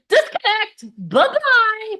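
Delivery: high, loud curt brush-off; mock dismissive hang-up voice. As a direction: cut them off like hanging up a call. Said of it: "she'd be like".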